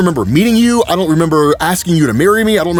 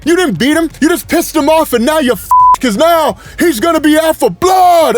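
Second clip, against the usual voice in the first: movie quote shout; 9 semitones higher than usual. Retold or imitated. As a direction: yell the warning like the action-movie guy. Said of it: "like that guy said to John Lithgow".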